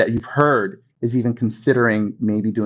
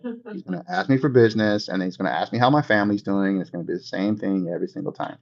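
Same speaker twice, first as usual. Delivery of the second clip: weary, lower, softer groan of an annoyed friend; annoyed contact; 6 semitones lower, 5 dB quieter than usual. Retold or imitated. Friend dreading the agent's call, weary groan. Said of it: "You don't want them to see you as".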